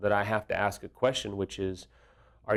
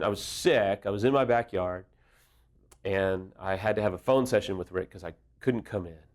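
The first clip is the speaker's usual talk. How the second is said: normal talking voice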